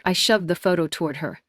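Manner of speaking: ordinary delivery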